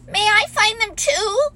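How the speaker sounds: put-on high, eager child plea; eagerly. A narrator's reading. Roo begging Kanga, high and eager. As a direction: beg to come along. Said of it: "asked Roo eagerly".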